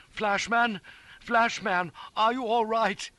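high, loud urgent call; alarmed calling. A narrator's reading. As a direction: call out anxiously for an injured friend. Companion calls after sled crash.

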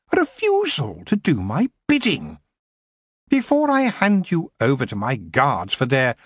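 cold, imperious queen voice, pitched high; haughty elf queen. A narrator's reading. Queen to goblin prisoner, imperious and cold. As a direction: speak down to him like a queen.

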